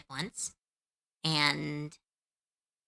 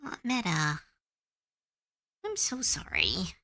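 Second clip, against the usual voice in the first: tender sympathy. Real, not acted. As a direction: hush it down to comfort her. Comforting a sad chatter.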